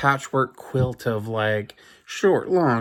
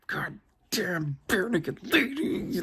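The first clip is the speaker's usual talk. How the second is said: mad grumble; 8 semitones higher, 7 dB quieter than usual. Retold or imitated. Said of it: "Like, just so mad".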